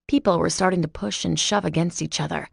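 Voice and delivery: plain reading voice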